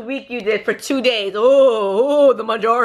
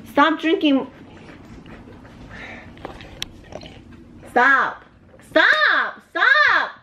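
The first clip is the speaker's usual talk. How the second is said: rising, higher and higher stop; pleading stop; 7 semitones higher, much slower than usual. Real, not acted. Wife begging husband to quit drinking her juice, rising pleas. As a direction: beg him to stop, pitch climbing.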